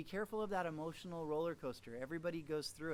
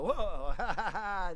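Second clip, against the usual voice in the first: loud, surprised whoa that tips into laughter; jump, then laugh it off; startled laugh; 4 semitones higher, 8 dB louder, slower than usual. Retold or imitated. Retold startled haunted house visitor.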